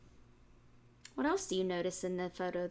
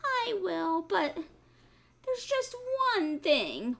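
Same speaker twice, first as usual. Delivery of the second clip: high piglet voice, hesitant; sly piglet; 10 semitones higher, 3 dB louder, slower than usual. A narrator's reading. Piglet hinting at a plan to the fox. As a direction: build up to the trick. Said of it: "sighed the piglet".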